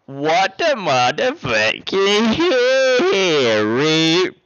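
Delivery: very drawn-out, high, loud holler; stunned yell. Real, not acted. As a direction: stretch it into an over-the-top shout of disbelief. Stunned by donor's big gift.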